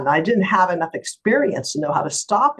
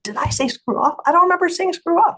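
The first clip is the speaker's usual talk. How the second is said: high-pitched retold disbelief; incredulous; 14 semitones higher, 3 dB louder than usual. Lawyer questioning her client's account, retold incredulous surprise.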